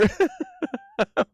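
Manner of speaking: very high-pitched burst of laughter; squealing laugh. Real, not acted. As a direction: cackle way up in the voice. Cracks up at fan art.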